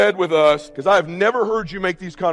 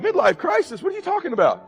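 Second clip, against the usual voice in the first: high-pitched incredulous comeback; mock indignation; 10 semitones higher than usual. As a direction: squeak it out in affronted disbelief. Acts own indignant reply to daughter.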